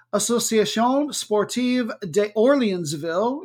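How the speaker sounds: put-on slow, patchy French accent; half-committed French accent, drawn out; bad French accent. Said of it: "slipping in and out of my bad French accent".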